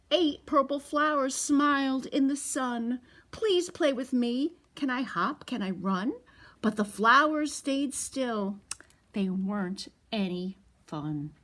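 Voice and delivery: picture book read to toddlers; storytime reading. A narrator's reading. Reads the picture book aloud.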